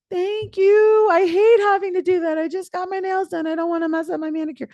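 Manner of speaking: high, fast, prissy put-on voice; mock grateful partner. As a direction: play a pampered partner who just got her nails done. Grateful partner voiced in gas story.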